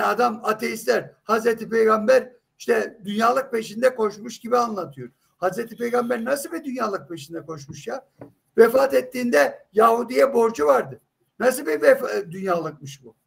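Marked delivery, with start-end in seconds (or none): none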